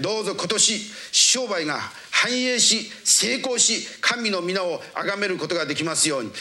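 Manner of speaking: high-pitched, insistent intercession; fervent preaching prayer. Real, not acted. Preacher praying over the congregation and Japan, fervent plea. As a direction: pray with driving fervour, phrase upon phrase.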